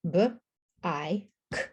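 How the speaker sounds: "b, I, k" one sound at a time; quick "b", long "I", clicked "k"; three separate sounds in "b, I, k". Shown as a demonstration. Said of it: "there are three sounds".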